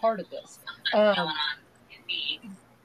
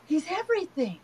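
higher-pitched retold child's wonder; imitating grandson; 8 semitones higher than usual. Six-year-old telling Nana about God, imitated wonder. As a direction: lightly voice a little boy's revelation. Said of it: "He goes".

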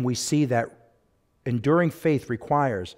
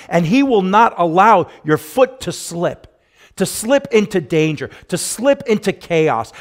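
preaching at full force; 3 semitones higher, 5 dB louder than usual. Acted. Pastor proclaiming God's promise to the church, loud full-force preaching. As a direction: build it up like a revival preacher.

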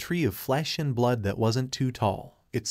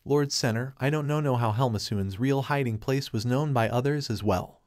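ordinary delivery, nothing marked

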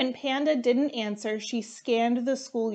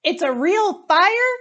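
high, loud panicked scream; terrified question at full volume; much higher frightened scream; 8 semitones higher, 9 dB louder than usual. Acted. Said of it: "Alligator screamed".

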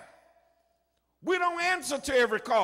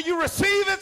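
loud, raised-pitch revival question; mock healer; 6 semitones higher, 5 dB louder than usual. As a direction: call it out like a play-acting faith healer. Play-acted faith healer asking child.

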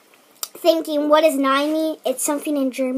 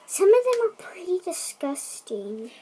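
whispering; 2 semitones higher, 7 dB quieter than usual. Real, not acted. Whispered aside to camera.

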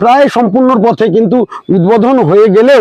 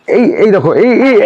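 cathedral echo. Shown as a demonstration. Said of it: "ইকো হবে".

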